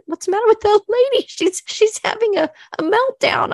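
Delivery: high, loud, fast mock gossip with a laugh; shocked onlooker voice. Imagining a churchgoer gawking at her crying in the pew, high loud mock gasp. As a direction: squeal it like a startled churchgoer staring at her. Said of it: "they'd be like, 'What".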